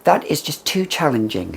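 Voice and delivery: imitating a shocked customer, hammed-up; mock dismayed shopper. Mimicking people who find the perfume too challenging, mock horror. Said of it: "People say".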